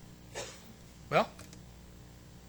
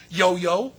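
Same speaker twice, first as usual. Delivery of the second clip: ordinary delivery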